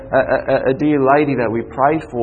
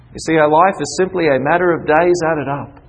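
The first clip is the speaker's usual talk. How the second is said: usual speaking voice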